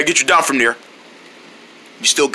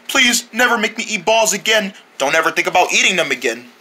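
ordinary talk

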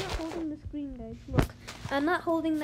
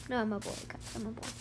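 lowered voice; 4 semitones lower, 7 dB quieter, faster than usual. Real, not acted. Drops pitch to insist he's a boy.

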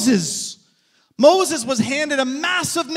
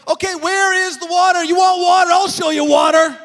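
louder, higher, building to a shout; angry Moses; 5 semitones higher, 4 dB louder than usual. Retold or imitated. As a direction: Moses losing his temper at the people. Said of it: "And Moses said at one point".

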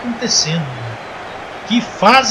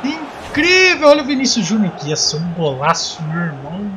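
play-by-play commentary; 4 semitones higher, 4 dB louder, slower than usual. Acted. Live football play-by-play.

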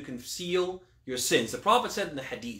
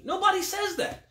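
much higher, incredulous rejection; emphatic disbelief. Real, not acted. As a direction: toss the line up high in disbelief. Rejecting an imagined objection.

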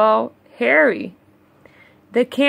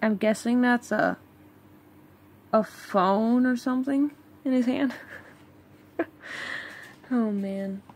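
fast, softer side remark; soft quick aside; 9 dB quieter, faster than usual. Real, not acted. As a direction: toss it off quietly to yourself.

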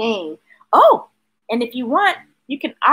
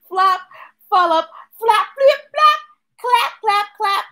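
playful sound words; 8 semitones higher than usual. Acted. Sound words read again.

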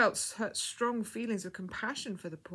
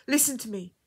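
louder, higher, insistent; urgent; 4 semitones higher, 6 dB louder than usual. A narrator's reading. Woman speaking to the dying girl, urgently. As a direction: demand attention at once. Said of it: "she said urgently".

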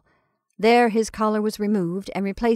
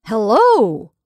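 loud booming hello; huge shout; 9 dB louder than usual. Acted. Jumbo silencing the hall, huge shout. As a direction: bellow it out. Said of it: "given vent to a huge".

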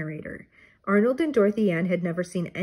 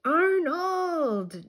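high call across the campground; 9 semitones higher than usual. A narrator's reading. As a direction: call his name from a distance. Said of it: "Jody called".

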